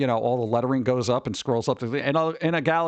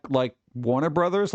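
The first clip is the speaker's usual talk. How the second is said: normal talking voice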